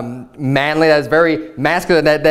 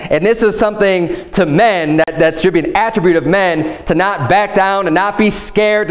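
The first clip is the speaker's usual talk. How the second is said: normal talking voice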